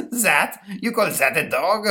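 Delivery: high voice breaking into laughter; laughing French accent. Acted. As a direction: crack up mid-sentence. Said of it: "the ghost starts cracking up".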